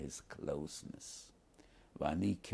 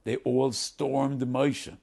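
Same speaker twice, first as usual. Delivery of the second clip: dramatic telling; 6 semitones higher, 9 dB louder, much slower than usual. A narrator's reading. Tribes storming Moshe.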